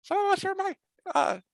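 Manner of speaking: very high, tiny squeaking protest; a small mouse squeaking to her leader; squeaky mouse. Acted. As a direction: squeak out an indignant correction. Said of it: "one of the mice squeaks".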